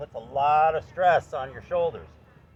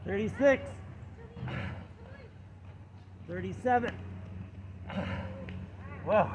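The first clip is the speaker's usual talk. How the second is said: doing push ups; 6 semitones higher, 5 dB quieter, slower than usual. Real, not acted. Final push-up reps, straining.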